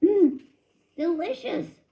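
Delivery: strained, choked word while swallowing; forcing praise through a mouthful; choking it out. A narrator's reading. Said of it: "choked Christopher".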